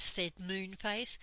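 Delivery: plain reading voice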